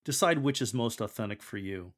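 ordinary delivery

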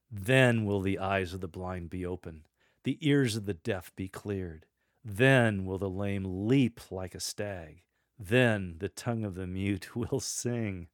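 "Then" opens each promise, drawn out and strong; stress on Then. Shown as a demonstration. Lector promising miracles to come, "Then" emphasized. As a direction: lean on every "Then" as a sure future time. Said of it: "emphasize the word "then".

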